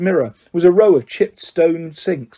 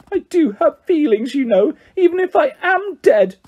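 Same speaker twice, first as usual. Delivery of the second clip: put-on high, tearful ghost girl; 11 semitones higher than usual. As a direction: hold back tears, voice high. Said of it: "in a voice choked with tears".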